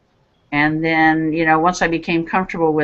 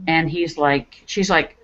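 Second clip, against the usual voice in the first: usual speaking voice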